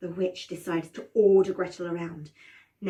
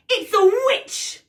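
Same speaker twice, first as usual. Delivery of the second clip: high, loud, drawn-out alarm; shocked reveal; 12 semitones higher, 7 dB louder, slower than usual. A narrator's reading. Narrator reveals the old woman is a witch, shocked. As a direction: announce it like a horrifying discovery.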